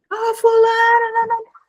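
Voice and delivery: irritated scolding. Retold or imitated. Repreende o filho que bagunçou. (mãe irritada repreendendo o filho, voz bem mais aguda)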